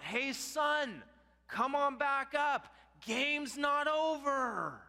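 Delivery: high, drawn-out calling across a field; dad yelling out. Retold or imitated. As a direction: imitate a father hollering to his son from afar. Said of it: "my dad yells out".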